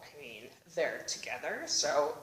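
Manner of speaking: low, soft, unbothered; low, dismissive shrug; shrugging cops. Retold or imitated. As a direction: brush it off like a bored cop. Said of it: "They're like".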